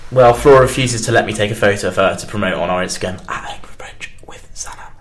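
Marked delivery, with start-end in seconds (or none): 3.3-4.9 quick whispered aside; whispering. Real, not acted. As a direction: whisper the show name. Whispered plug in radio chat.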